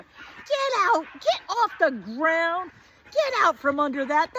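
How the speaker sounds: high, loud mock yell of a cranky old man. Tired boyfriend yells at her to get out from under the house, in a high, loud mock yell. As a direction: bark it out like a tired boss calling her back.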